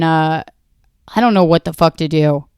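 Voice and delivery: ordinary talk, nothing marked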